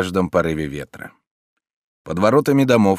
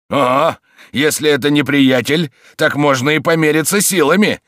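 much higher and louder, spoiling for a fight; challenging shout; 8 semitones higher, 7 dB louder than usual. A narrator's reading. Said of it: "закричал живодёр". (вызов на драку, громкий крик)

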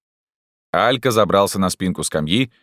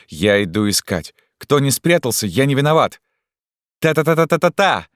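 calling out; 7 semitones higher than usual. A narrator's reading. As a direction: shout the hide-and-seek call. Hide-and-seek call out.

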